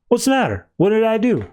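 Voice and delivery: high-pitched innocent act; retold who-me questioning in a high voice; feigned innocence. Harley feigns innocence to horrified kids.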